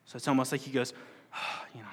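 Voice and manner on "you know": soft breathy sigh of relief; relieved sigh. Retold or imitated. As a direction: let the breath out, safe now. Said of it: "oh, I get his breath out".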